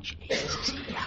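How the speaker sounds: silent mouthed words, no voice; mouthed silently. Retold or imitated. Kids mouthing to friends from the chairs, silently. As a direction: mouth it with no sound, thrilled kid. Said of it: "mouthing, like".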